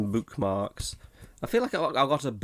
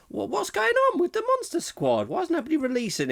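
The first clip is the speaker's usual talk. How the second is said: much higher, outraged squeak; indignant squeal; faster than usual. Real, not acted. Host demands why the film is unreleased in a squeaky outrage. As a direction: shriek it in disbelief. Exasperated outburst about UK release.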